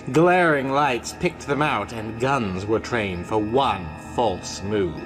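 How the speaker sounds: plain reading voice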